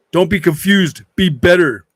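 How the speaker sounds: loud, punchy command to a viewer; emphatic admonishment. Real, not acted. Streamer telling confused chatter to shape up, emphatic admonishment. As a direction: tell them off loudly and firmly.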